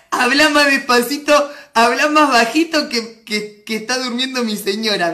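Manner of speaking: comically loud reading of a hush; mock shout. Defiantly loud reading of request.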